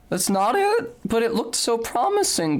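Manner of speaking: high, disbelieving protest; real high-pitched disappointment; high-pitched dismay. Real, not acted. Dismay at wrong path in game.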